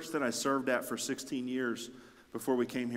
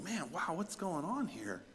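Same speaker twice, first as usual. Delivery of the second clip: hushed amazement; 5 semitones higher than usual. Retold or imitated. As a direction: marvel under your breath. Acts own amazed reaction to news.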